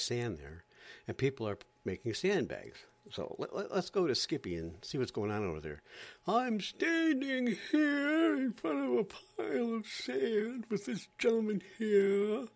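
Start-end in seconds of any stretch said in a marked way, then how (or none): 6.3-12.5 high, slow, affected TV reporter; much higher, slow hammed-up newscaster; mock reporter voice. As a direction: stretch it out in a prissy reporter voice. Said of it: "Don't talk like that".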